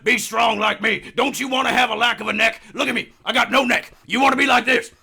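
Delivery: loud, high shouting supplement pitchman; hard-sell the pills, hammed up; Alex Jones pitch. Host mocking the broadcaster selling supplement pills, shouting. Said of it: "such a good Alec Jones".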